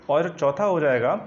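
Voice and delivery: ordinary talk, nothing marked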